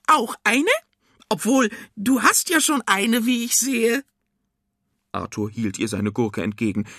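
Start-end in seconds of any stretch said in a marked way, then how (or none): 0.1-4.0 put-on very high old-woman witch voice; perky cucumber witch. A narrator's reading. Cucumber witch offering Arthur a cucumber, perky witch voice.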